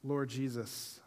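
soft, quiet closing prayer. Acted. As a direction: pray softly over the congregation. Leads prayer.

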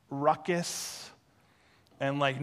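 ordinary delivery

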